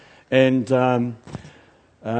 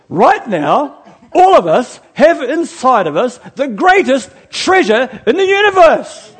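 big drawn-out shout, rising higher; slow, emphatic crowd-rousing proclamation; borrowed preacher's shout; 9 semitones higher, 7 dB louder, slower than usual. Retold or imitated. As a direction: build to a roaring high finish. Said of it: "I stole that from Bill Johnson".

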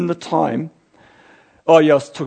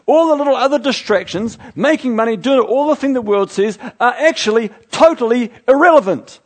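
raised, louder preaching voice with punched key words; impassioned preaching; 7 semitones higher, 5 dB louder than usual. Real, not acted. Preacher urging the church that we're in it now, impassioned. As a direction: drive it home to the congregation.

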